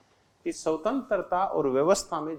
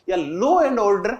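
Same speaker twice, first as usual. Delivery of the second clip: law and order stretched out slowly and loudly; teacher spelling out a key term slowly; slow emphasis; 4 dB louder, much slower than usual. Shown as a demonstration. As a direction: lean on "law and order", drawing each word out.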